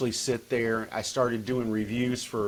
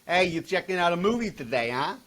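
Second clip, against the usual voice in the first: New Jersey voice; 6 semitones higher than usual. Retold or imitated. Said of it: "dictating what people are saying".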